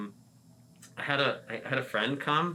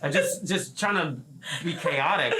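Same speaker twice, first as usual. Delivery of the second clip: laughing; 5 semitones higher, 4 dB louder, slower than usual. Real, not acted. Laughing through tears.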